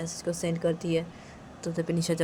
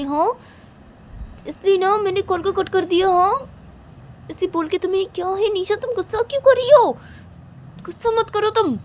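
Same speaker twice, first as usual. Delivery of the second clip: high, loud irritated complaint; exasperated friend; 11 dB louder than usual. Acted. Friend defending having cut the call, high and exasperated. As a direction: grumble in a raised, high voice at an unreasonable friend.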